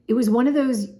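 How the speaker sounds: ordinary delivery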